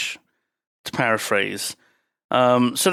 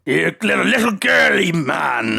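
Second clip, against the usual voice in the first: gruff sailor taunt. Retold or imitated. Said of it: "Oh, yeah".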